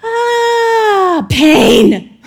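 mock baby cry, loud on pain; baby wail. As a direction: wail like a newborn waking. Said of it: "They're like".

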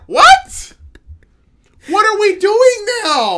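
high, loud shocked cry; shocked disbelief. Real, not acted. Exasperated disbelief.

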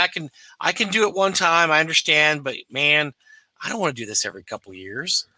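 ordinary talk, nothing marked

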